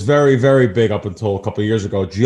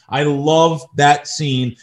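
ordinary talk, nothing marked

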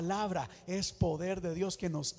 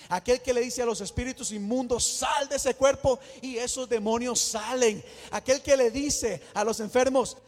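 loud, rousing preaching to the church; full-force preaching; 7 dB louder than usual. Acted. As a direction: build the crowd up, loud and driving.

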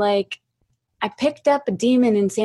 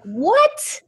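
shocked high what; 13 semitones higher than usual. Retold or imitated. Retold shock at devil remark.

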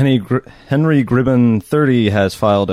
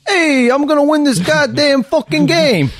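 put-on much higher, louder brash East Coast accent; hammed-up East Coast accent; 14 semitones higher, 4 dB louder than usual. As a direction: yell it like a stubborn Jersey gambler. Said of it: "everybody from the East Coast sounds".

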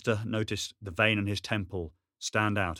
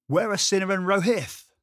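anxious call; 12 semitones higher, 9 dB louder than usual. Acted. Anxious call through window.

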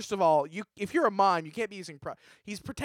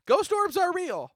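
emphatic insistence; 14 semitones higher, 6 dB louder, slower than usual. Acted. Insisting orbs are real.

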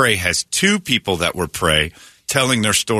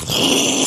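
imitated water whoosh. Storyteller imitating an elephant surging in the river, breathy whoosh. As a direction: blow out a rush of air.

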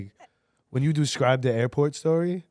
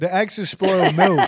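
laughing through the line; 4 semitones higher than usual. Real, not acted. Repeats punchline laughing.

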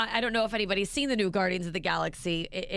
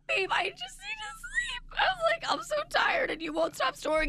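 much higher, near crying; tearful plea. Retold or imitated. Wife desperate for sleep, high tearful plea. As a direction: beg him, almost sobbing. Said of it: "I'm like".

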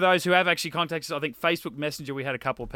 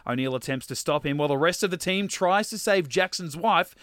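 dramatic trailer read; 4 semitones higher, 5 dB louder than usual. Acted. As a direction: read the blurb like a hammed-up film trailer. Reads DVD blurb as trailer.

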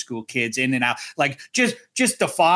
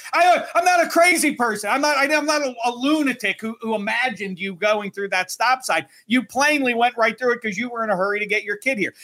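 high-pitched, worked-up argument; retold yelling; 8 semitones higher than usual. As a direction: protest indignantly at the other driver, pitch up. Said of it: "And now I'm yelling".

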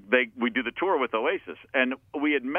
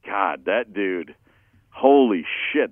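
awestruck; 4 semitones lower, 5 dB louder, slower than usual. Retold or imitated. Awed inner reaction to Liam.

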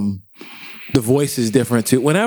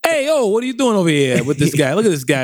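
raised, nosy New York voice; New York construction worker. Pretend. Worker busting into the podcast room, nosy New Yorker. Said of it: "New York construction worker who just, like, busts".

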